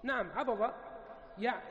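normal talking voice

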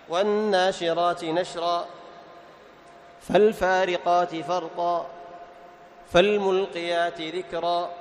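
slow, measured recitation of the verse; slow tajweed reading, held vowels; Quran recitation. Shown as a demonstration. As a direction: recite the verse deliberately, as in class. Recites verses to test pauses.

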